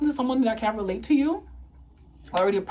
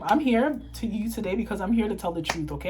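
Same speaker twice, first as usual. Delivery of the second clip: ordinary delivery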